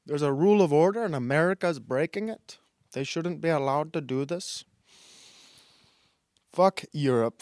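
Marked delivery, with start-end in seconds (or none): 0.0-4.6 badly faked Indian-Mexican mix accent; mock complaint in a muddled Indian Mexican accent. Host standing in for upset Europeans, fake Indian Mexican accent. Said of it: "an Indian Mexican accent".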